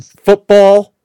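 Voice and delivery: FOOTBALL high, loud and held level; "Football" proclaimed loudly on a high note; deliberately exaggerated pronunciation. Shown as a demonstration. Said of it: "I have to do it this way".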